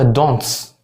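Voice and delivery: "دونتس" with an exaggerated final s; Scouse final t. Shown as a demonstration. Teacher showing how Liverpool says don't, "دونتس" with added s. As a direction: lean on the s after the t in "دونتس". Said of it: "وهما بيضيفوا اس بعد التي".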